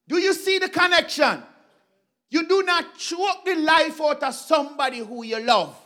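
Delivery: normal talking voice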